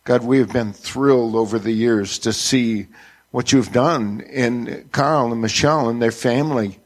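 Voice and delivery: ordinary delivery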